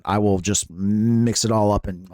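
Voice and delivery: ordinary talk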